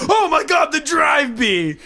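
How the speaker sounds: high, loud panicked yell, slow "drive bee"; character shrieking in panic at a bee; panicked shout. Retold or imitated. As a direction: scream it in terror at the bee. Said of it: "he's like".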